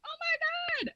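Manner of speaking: very high shouted what, then a hushed oh my God; high surprised yell fading to a hush; shocked shout. Retold or imitated. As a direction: scream in disbelief, then fall to breath. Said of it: "he was like".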